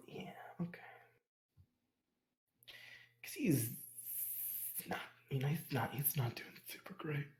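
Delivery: hushed aside, barely voiced; whisper. Real, not acted. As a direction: whisper it under your breath.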